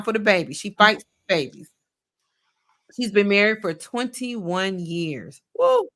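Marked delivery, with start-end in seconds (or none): 5.6-5.9 high, loud cheer; cheering woo. Real, not acted. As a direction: celebrate it. Cheering long marriage.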